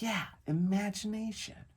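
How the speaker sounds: put-on high kid voice, pleased recognition; imitated little boy. Dad mimics his son getting the word back, high child voice.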